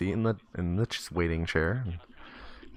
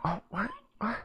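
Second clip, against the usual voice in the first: put-on high, soft bewildered kid; confused boy; 6 dB quieter than usual. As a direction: act out a child at a loss, pitched up. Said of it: "he's just confused".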